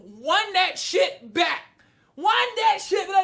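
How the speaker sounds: high, drawn-out demand; slow, hammed-up outraged yell; incredulous protest. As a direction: stretch it out in loud disbelief. Re-enacts protest at the screen.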